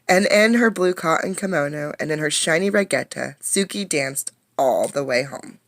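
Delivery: put-on lively read-aloud for young kids; story reading. A narrator's reading. A teacher reading a storybook to her class, lively. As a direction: bring the picture book to life.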